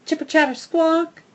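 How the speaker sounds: high, loud chattering squeal; squirrel screech. A narrator's reading. Squirrel screeching back at the dog, high squeal. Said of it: "he screeches again".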